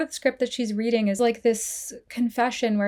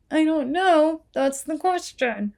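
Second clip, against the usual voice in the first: mock exasperated retort; 6 semitones higher, slower than usual. Answering why this character kills herself, mock exasperated. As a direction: act out a slow, emphatic comeback. Acted retort to Rachel's naive question.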